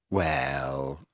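low, soft, doubtful drawn word; reluctant, sunken-pitched doubt; thoroughly unconvinced. A narrator's reading. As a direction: sound deeply sceptical. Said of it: "sounding thoroughly unconvinced".

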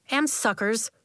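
usual speaking voice

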